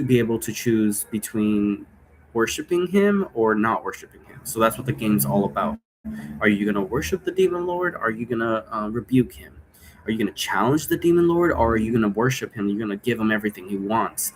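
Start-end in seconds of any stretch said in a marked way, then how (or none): none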